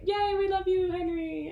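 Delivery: high, light cheer; mock the crowd's cheer; high mock fan cheer. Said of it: "it's like".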